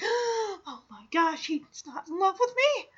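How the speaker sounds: hushed, high gasp of horror; gasp, then squeak it out softly, aghast; mock shock. Imitating Margot overhearing she is not loved, shocked. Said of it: "has this shocked look on her face".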